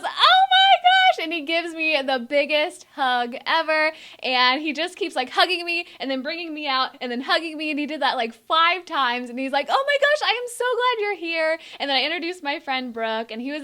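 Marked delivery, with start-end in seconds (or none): 0.0-1.2 very high, slow, loud gasp of delight; thrilled to see her, squeal it out; excited squeal. Retold or imitated. Singer greeting his fan, a high, loud, drawn-out squeal. 9.7-11.6 high, delighted welcome; excited. Retold or imitated. Singer welcoming his fan, high delighted gushing.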